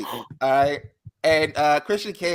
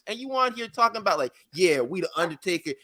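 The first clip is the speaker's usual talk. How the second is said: higher, heated lecture to a rapper; heated rant; 10 semitones higher than usual. Retold or imitated. Telling [name] off about his albums, raised voice. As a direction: lay into him, pitch raised. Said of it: "I'm like".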